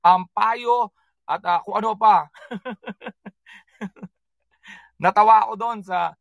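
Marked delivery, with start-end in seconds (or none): 2.5-3.3 a soft run of chuckles. Real, not acted. Host amused during the live show, soft chuckling.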